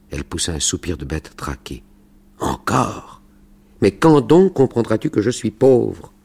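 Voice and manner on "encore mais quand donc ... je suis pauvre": loud, high, fed-up outburst; exasperated. Acted. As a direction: snap back at the begging son. Exasperated mother refuses money again.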